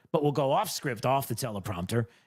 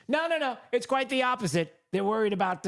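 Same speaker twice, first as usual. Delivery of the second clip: no no no, pitched way up; high protest; 10 semitones higher than usual. Real, not acted. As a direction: jump up high to refuse the idea.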